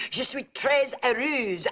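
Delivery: slow, careful French: Je suis très heureuse; French phrase. Shown as a demonstration. Aunt shows niece a French sentence, slow French. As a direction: say it slowly in schoolbook French. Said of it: "I am very happy".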